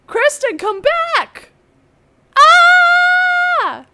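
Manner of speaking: high, loud call after someone gone. Real, not acted. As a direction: shout her name, then cry out. Calling lost call partner.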